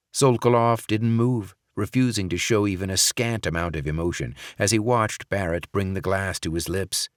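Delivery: ordinary delivery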